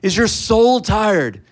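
loud, slow street call. Retold or imitated. Preacher calling to passersby, loud and slow. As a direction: drag it out to the crowd. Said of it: "keep doing it".

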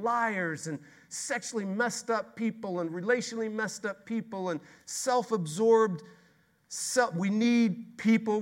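high, loud, ringing sermon; fiery preacher building to a peak; full-force preaching. Acted. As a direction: preach it out with mounting wonder.

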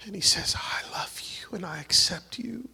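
tender, whispered comfort over the congregation; hushed whisper. Real, not acted. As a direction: lean in and breathe the words to the hurting. Emotional ministry moment, hushed.

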